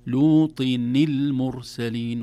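"لوط المرسلين" with a kasra-voiced n, lootin-il; tajweed linking demo; slow recitation. Shown as a demonstration. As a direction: link "لوط" into "المرسلين" slowly with nin. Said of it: "أما مع ما قبلها".

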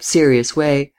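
usual speaking voice

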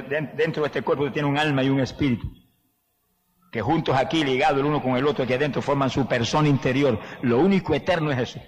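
ordinary talk

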